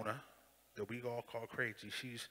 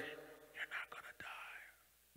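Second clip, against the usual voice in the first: whispered take; 14 dB quieter, much slower than usual. Shown as a demonstration. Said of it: "stern, firm voice".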